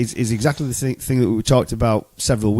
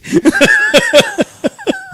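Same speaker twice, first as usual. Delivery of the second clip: high hooting laugh; loud laugh; 4 dB louder than usual. Real, not acted. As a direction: burst out laughing. Burst of laughter at joke.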